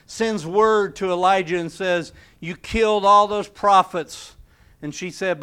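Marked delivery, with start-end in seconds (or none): 2.4-4.4 loud, accusing jab from Jezebel; accusing queen. Retold or imitated. Jezebel's messenger hurls the charge at Elijah, loud and accusing. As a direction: throw the charge at him like a furious queen.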